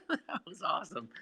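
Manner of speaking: high laugh into the words; laugh, then praise it through the laughter; real laughing. Real, not acted. Co-host reacting to the song that just played, laughing.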